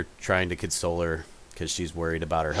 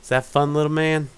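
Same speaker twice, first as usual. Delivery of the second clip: mother voice; 8 semitones higher, 5 dB louder, faster than usual. Acted. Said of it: "you hear the woman say".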